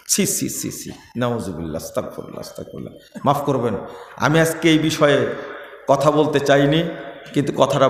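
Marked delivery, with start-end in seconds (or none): 0.1-3.2 unvoiced tutting into a hushed prayer; whispered astaghfirullah. Real, not acted. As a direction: breathe it out almost silently. Whispered repentance. (ফিসফিস করে আস্তাগফিরুল্লাহ)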